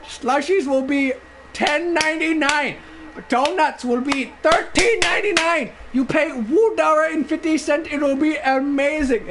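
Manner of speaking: high, animated mock Indian accent; Indian clerk impression. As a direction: proudly announce prices in a put-on Indian accent. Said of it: "doing this impression".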